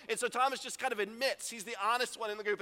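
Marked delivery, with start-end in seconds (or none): none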